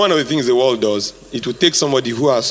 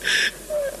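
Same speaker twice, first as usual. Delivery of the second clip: a long wheezy high-pitched laugh; breathless laughter; 8 dB quieter than usual. Real, not acted. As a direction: laugh hard at your own joke. Laughs at joke on salaries.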